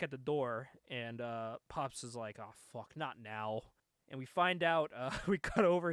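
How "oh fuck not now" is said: muttered dread. Retold or imitated. Pops dreading a knock.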